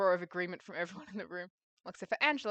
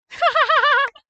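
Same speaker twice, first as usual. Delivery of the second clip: high burst of laughter; 16 dB louder than usual. Real, not acted. Big laugh at the table.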